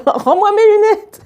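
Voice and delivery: put-on high-pitched child's yell; very high acted scream. A narrator's reading. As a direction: shriek the demand like a furious kid. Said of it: "en hurlant".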